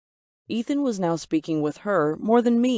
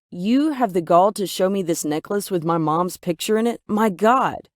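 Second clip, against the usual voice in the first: loud, rising outraged accusation; 2 semitones higher, 5 dB louder than usual. Acted. Daughter raging at Ethan over the necklace photo, loud. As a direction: blow up at him, incensed. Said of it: "I was incensed, outraged".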